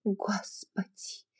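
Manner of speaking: soft, slow, startled whisper; frightened whisper. Acted. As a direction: gasp it out in fright. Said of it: "испуганно прошептала она в ответ".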